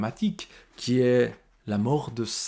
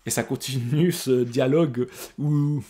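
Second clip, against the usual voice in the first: normal talking voice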